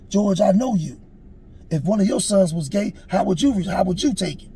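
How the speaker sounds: retold emotional challenge to a friend; pointed challenge. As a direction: put him on the spot, pressing hard. Friend challenges him in retold argument.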